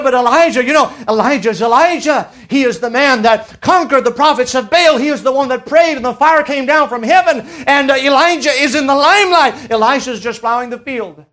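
ordinary talk

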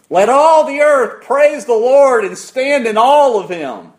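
high, loud, slow proclamation; full-force preaching. Real, not acted. Preacher calling all the earth to praise, thundering proclamation. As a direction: ring it out to the whole room.

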